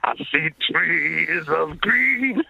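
slow sung [name] line. Retold or imitated. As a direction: croon it slowly like [name]. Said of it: "[name]".

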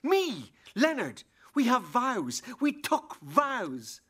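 high, angry accusation. Acted. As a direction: hurl the betrayal at her. Husband's angry outburst.

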